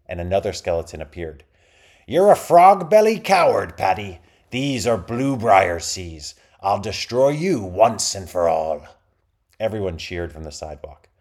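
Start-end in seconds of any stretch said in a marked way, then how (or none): 2.1-8.8 loud, slow, growling pirate threat; pirate captain voice. A narrator's reading. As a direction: snarl defiance at the rival. Pirate captain answers rival.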